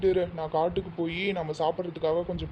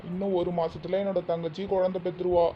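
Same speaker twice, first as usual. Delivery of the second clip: ordinary delivery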